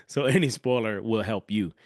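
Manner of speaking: ordinary delivery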